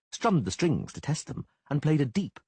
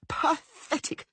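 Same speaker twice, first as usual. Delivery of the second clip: much higher shriek on the repeat; much slower than usual. Acted. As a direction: top the first one, even shriller. Jilted woman screams insult.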